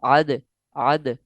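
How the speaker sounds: आद with the final द bounced in a short echoing release; qalqala. Shown as a demonstration. Teacher showing students the qalqala bounce on आद. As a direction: pop the द of आद, slow and deliberate. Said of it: "यहाँ हम कलकला करेंगे".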